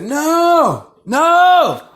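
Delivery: put-on high, loud little-boy no; toddler's shrill refusal; child yelling no. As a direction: whine it like a stubborn toddler. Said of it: "He just kept yelling".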